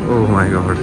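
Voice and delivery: normal talking voice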